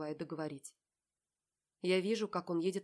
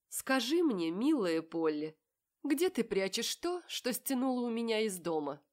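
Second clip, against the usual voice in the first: Marat's voice; 5 semitones higher, 6 dB louder than usual. A narrator's reading. Marat voiced, menacing tease.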